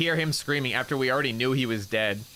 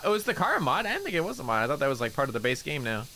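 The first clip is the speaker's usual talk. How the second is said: ordinary talk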